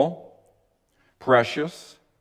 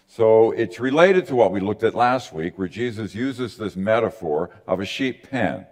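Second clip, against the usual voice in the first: ordinary delivery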